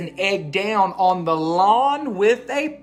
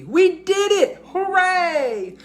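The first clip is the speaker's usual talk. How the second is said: high, loud joyful cheer; 12 semitones higher, 4 dB louder than usual. A narrator's reading. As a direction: celebrate the win. Celebrating the finished job.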